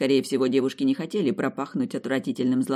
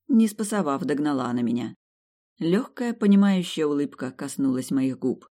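ordinary delivery

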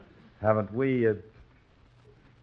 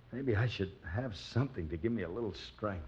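sneaky quiet; 11 dB quieter than usual. Acted. Plans to sneak into kitchen.

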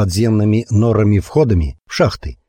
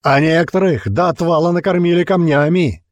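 booming speech; 8 semitones higher than usual. A narrator's reading. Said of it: "Его голос грохотал над лагерем".

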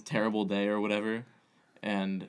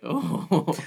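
surprised oh; 6 semitones higher, 7 dB louder than usual. Retold or imitated. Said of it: "and I was like".

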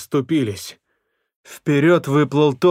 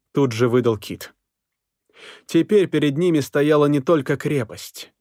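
usual speaking voice

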